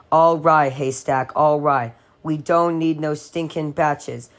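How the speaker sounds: ordinary delivery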